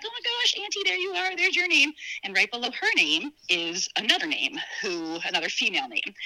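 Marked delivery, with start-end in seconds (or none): 0.0-1.9 high-pitched, delighted re-enactment; retold excited discovery. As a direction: act out spotting a relative, voice leaping up. Retold excited discovery of aunt's name.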